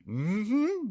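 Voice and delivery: high, soft smug hum. Retold or imitated. Gunman answering the lawman, all smug. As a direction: hum it, very pleased with yourself. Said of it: "being all smug".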